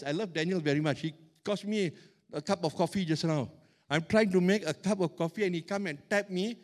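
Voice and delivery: ordinary delivery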